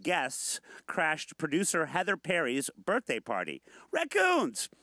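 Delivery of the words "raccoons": high, sudden exclamation; excited exclaim. Real, not acted. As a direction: shout it out with a jolt. Excited announcement of raccoons.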